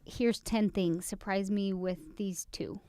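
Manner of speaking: wife mocking her own picky request, slow and soft. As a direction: hand over the list lightly. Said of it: "more like".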